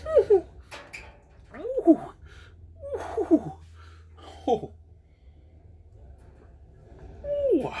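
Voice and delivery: high laughing puckered ooh; loud high ooh at the sour taste. Real, not acted. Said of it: "do the ooh face".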